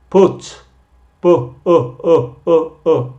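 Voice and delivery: put, pu, u said loud, isolating the short u; teacher drilling the vowel in put. Shown as a demonstration. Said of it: "El sonido aquí es un u".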